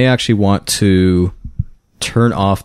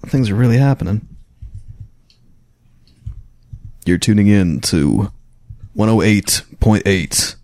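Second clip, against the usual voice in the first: radio DJ voice. Pretend. Mock radio station intro.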